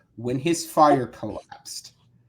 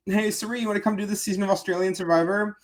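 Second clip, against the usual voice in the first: quick, higher-pitched imitated producers; put-on higher voice making an offer; casual invite; 6 semitones higher, faster than usual. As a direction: lightly voice an upbeat invitation. Voicing producers inviting a player.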